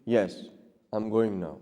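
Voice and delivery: low, soft, even answer; reply quietly, with no edge; calm reply. Shown as a demonstration. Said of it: "Your response will be".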